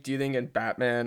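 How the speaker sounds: usual speaking voice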